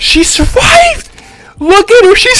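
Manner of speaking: shocked disbelief. Real, not acted. Enemy survives with low health.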